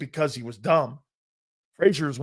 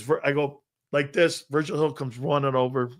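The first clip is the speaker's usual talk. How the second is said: usual speaking voice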